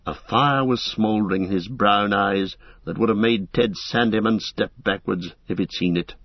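ordinary delivery

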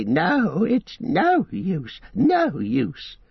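high, slow, quaking creature voice; terrified Gollum. Acted. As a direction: Gollum panicking that the enemy senses the precious.

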